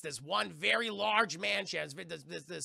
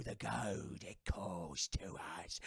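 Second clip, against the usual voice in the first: much lower, soft, slow hungry whisper; eerie ghost whisper; 12 semitones lower, 7 dB quieter, much slower than usual. Retold or imitated. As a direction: breathe it like a ghost starving for gold. Cursed pirates craving gold.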